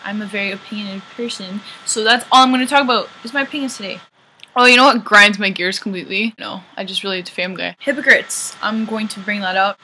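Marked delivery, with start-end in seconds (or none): none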